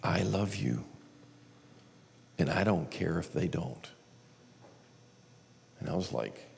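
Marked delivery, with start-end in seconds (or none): 0.0-3.9 hushed reassurance from within; little inner voice. Retold or imitated. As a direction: speak it gently and quietly, with long pauses. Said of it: "I hear this little voice say".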